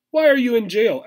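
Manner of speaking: high, far-off streetwise squirrel voice; tough squirrel. Acted. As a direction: play the squirrel like a jailhouse con. Squirrel character voice.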